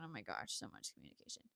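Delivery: half-laughing whispered exasperation; exasperated whisper. Real, not acted. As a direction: sigh it out under your breath. Exasperated aside after chant.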